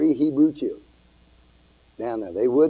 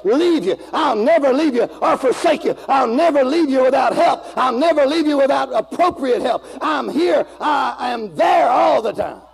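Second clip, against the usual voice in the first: preaching at full force; 9 semitones higher than usual. Acted. Preacher proclaiming Jesus' promise to the church, fervent. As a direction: preach it with fire.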